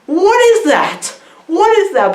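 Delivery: high, comic shriek of bewilderment; imitated scream at moderate volume; mock screaming. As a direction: cry it out high in pretend shock. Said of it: "he's screaming".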